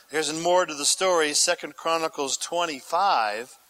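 ordinary delivery

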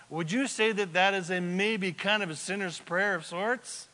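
usual speaking voice

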